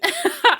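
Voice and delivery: a high, loud giggle; quick high-pitched laugh. Real, not acted. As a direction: laugh freely while signing off. Laughing at wrap-up.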